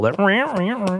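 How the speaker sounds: high, soft squawky noise imitation; mock hand-fart squeak. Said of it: "pitched up a little".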